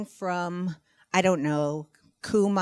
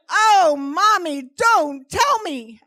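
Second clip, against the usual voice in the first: put-on loud, high, drawn-out child's wail; slow, dramatic plea in a child's dread; mock horror; 8 semitones higher, 9 dB louder, much slower than usual. Daughter horrified by mom's answer, hammed-up dismay.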